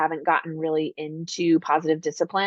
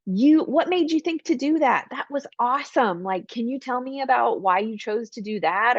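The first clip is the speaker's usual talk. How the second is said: higher, eager praise; encouraging parent; 5 semitones higher than usual. Shown as a demonstration. Parent praising a child's choice, eager and higher. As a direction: sound genuinely impressed with the kid. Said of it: "like, encouragement".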